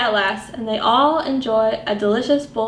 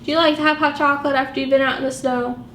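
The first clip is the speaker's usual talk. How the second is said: ordinary delivery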